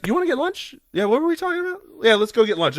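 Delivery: high, breezy casual voice; casual and oblivious. Retold or imitated. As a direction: chirp it like nothing happened. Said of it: "It just cuts".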